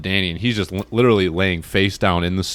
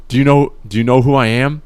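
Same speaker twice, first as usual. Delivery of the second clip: loud urgent question; 5 semitones higher, 9 dB louder than usual. Retold or imitated. Testing dazed friend's awareness.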